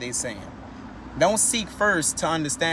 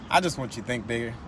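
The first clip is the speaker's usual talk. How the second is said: soft sincere plea; 6 semitones lower, 5 dB quieter than usual. Real, not acted. Sincere closing plea after a sigh.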